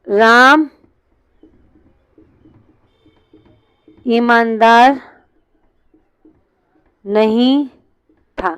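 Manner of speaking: राम... ईमानदार... नहीं... था, हर शब्द के बाद लंबा ठहराव; रुक-रुक कर लिखवाओ; word-by-word dictation. Shown as a demonstration. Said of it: "जैसे मैंने बोला". (क्लास को वाक्य लिखवाता टीचर, रुक-रुककर)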